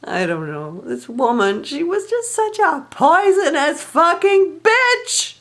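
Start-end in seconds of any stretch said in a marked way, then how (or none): 1.6-5.3 bitter outburst. Real, not acted. Venting about the woman.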